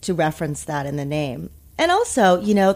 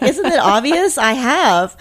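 exasperated, higher and louder comeback; annoyed retort; 8 semitones higher, 8 dB louder, slower than usual. Retold or imitated. Teen snapping back at grandmother's nightly call, exasperated. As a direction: throw it back like an irritated teen. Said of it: "I remember being so annoyed".

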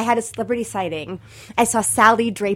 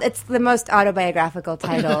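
normal talking voice

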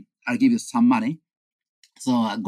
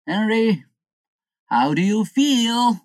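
calling to a sleeper; 6 semitones higher, 4 dB louder than usual. Acted. Friends rousing Henry from his bed, calling to a sleeper. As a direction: rouse him with a high, lilting question.

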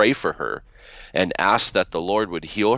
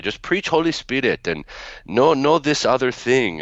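imitated Arabic-accented broken English, higher and warning; a mild Arabic accent, grandmother warning her son; 6 semitones higher than usual. Grandmother warning her son about a preacher, lightly Arabic-accented broken English. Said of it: "in her broken English".

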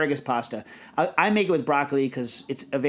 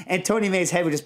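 ordinary talk, nothing marked

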